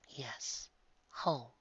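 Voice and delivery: soft, breathy kind angel; an angel soothing the girl in a whisper; gentle angel whisper. Acted. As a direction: whisper comfort to a dying child.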